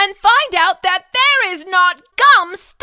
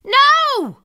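urgent shout; 7 semitones higher than usual. Acted. Stopping the kid.

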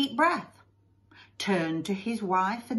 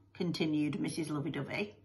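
usual speaking voice

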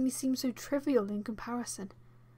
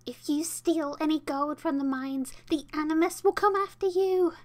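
put-on cackly old lady voice; 6 semitones higher, 7 dB louder than usual. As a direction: spin a scary tale like an old granny. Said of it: "a cackly old lady voice".

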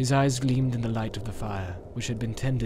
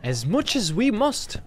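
high, slow hobbit voice, trying to sound brave; bravely hiding his dismay; sinking heart; 9 semitones higher, slower than usual. A narrator's reading. Pippin answering Strider about how much to carry. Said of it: "with a sinking heart".

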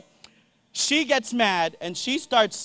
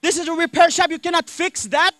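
pissed uncle; 8 semitones higher than usual. Retold or imitated. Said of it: "my uncle is pissed now".

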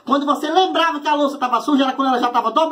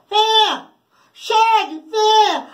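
angry mom calling; 8 semitones higher, much slower than usual. Retold or imitated. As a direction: call the kid sharply. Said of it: "desse jeito, ó".

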